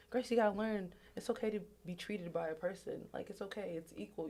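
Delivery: ordinary delivery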